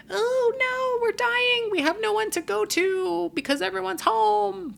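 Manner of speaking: tiny squeaky voice, very high and panicked; a cartoon germ crying out in very high squeaks; coronavirus particles voice. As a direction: play a virus particle wailing in fear. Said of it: "That's my coronavirus impression".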